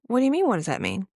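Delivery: usual speaking voice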